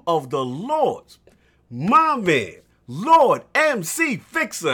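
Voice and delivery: loud, high ring announcer intro; hype announcer. Acted. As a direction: build the hype for the guest. Hyped guest introduction announcer.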